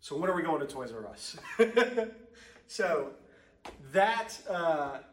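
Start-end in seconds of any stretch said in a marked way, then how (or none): 1.4-2.2 laugh. Real, not acted. Laughs after his joke.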